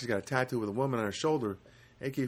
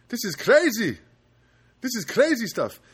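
higher, louder exclamation on "crazy"; exclaimed amazement; 9 semitones higher, 5 dB louder than usual. Acted. Comic reacting to a tattoo story, exclaiming in amazement. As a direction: burst out in amazed disbelief.

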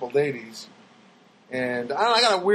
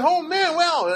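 gushing wow; 13 semitones higher, 3 dB louder, slower than usual. Retold or imitated. How he actually greeted him.

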